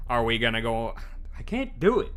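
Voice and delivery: put-on southern accent. Giving up on a Southern accent mid-line. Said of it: "the southern accent".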